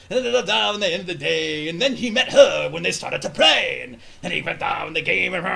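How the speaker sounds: sing-song theatrical pirate verse; improvised rhyme. Retold or imitated. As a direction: chant it out like a hammed-up officiant. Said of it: "improvised rhyme, like".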